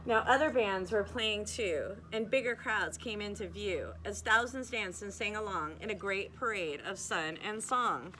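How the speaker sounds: lilting read-aloud storybook voice; cosy bedtime storyteller, rhythmic; story narration. A narrator's reading. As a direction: read the rhymes to children, bouncing the beat. Reads rhyming picture book aloud.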